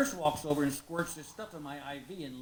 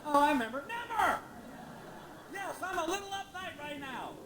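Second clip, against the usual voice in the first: higher, indignant little protests; lightly voiced flustered self; 10 semitones higher than usual. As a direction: squeak it out, caught and defensive. Answering nurse in his head.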